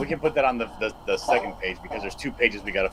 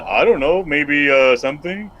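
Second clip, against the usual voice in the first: mock indecision; 2 semitones higher, 6 dB louder, slower than usual. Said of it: "you're not".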